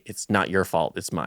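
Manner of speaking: understated remorseful apology; quiet self-blame. Retold or imitated. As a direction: take the blame gently. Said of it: "and say".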